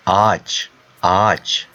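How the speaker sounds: "ağaç" as one long aach, the ğ silent; lengthened vowel. Shown as a demonstration. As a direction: hold the a of "ağaç", leaving ğ unpronounced. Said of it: "read that vowel a little bit longer".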